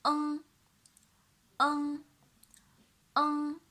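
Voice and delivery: "um" drilled slowly; clear demo syllable. Shown as a demonstration. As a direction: model "um" for copying. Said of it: "And the next one".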